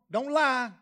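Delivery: ordinary delivery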